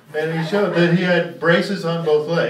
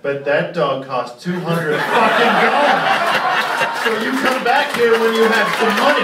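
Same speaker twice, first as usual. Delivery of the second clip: loud, higher furious yelling rant; mock rant; 7 semitones higher, 6 dB louder than usual. Comic voicing Harry yelling at the crippled kid, loud and furious. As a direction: scream the twist at the kid.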